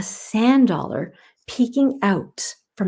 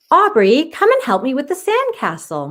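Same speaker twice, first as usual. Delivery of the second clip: higher, louder call across the sand; 6 semitones higher, 4 dB louder than usual. Acted. Maya calling Aubrey over to the sandcastle. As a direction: call her from a distance. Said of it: "Maya called".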